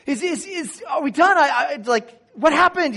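high, loud confused questions; groggy yelling. Retold or imitated. Waking from surgery, yelling questions at the staff. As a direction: blurt it out, dazed and too loud. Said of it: "I'm yelling at the top of my lungs".

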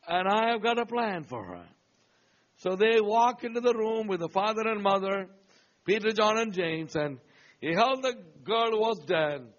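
full-voiced preacher telling a Bible story; storytelling sermon voice; preaching. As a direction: preach it out to the congregation, rising on the punch lines.

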